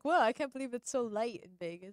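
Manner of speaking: sarcastic. Retold or imitated. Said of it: "I was being sarcastic".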